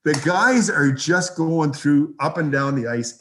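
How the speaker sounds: ordinary talk, nothing marked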